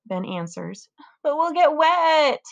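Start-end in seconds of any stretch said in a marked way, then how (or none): 1.2-2.4 high, slow whiny complaint; whiny little sister. A narrator's reading. Sister whining in the rain.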